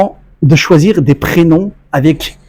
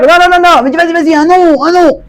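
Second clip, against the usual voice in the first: eager insistence; 7 semitones higher than usual. Retold or imitated. Friend urging him to guess.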